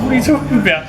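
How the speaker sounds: retold surprise. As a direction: recreate the disbelief of that moment. Retelling his shocked reply to friend.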